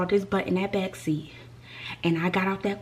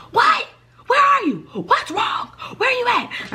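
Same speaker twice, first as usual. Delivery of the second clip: very high, shrieked questions; imitated screaming; 4 dB louder, slower than usual. Mom on the phone hearing of the accident, imitated screaming. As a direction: shriek it like a panicking mother. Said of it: "now she's screaming".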